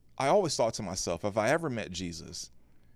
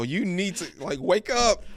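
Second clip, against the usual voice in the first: high, loud urgent plea to wake up; shaking someone awake, high and loud; wife being shaken awake, loud and high-pitched; urgent wake-up call; 10 semitones higher, 6 dB louder than usual. Retold or imitated. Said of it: "aggressively shake my wife".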